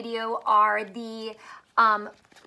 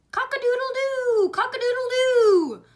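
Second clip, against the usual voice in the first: rooster crow; 11 semitones higher, 6 dB louder than usual. Acted. Teacher voicing the rooster for young readers, crowing high. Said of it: "What do you think that he's saying?".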